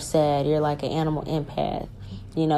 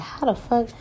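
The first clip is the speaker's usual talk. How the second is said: mock astonished outburst; stunned disbelief; 8 semitones higher than usual. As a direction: pitch jumps high in shock. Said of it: "And you're like".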